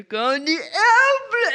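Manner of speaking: put-on slow, laughing, like feeding a toddler; spoon-feeding sing-song, cracking up; laughing airplane game. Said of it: "It doesn't sound like a dragonfly".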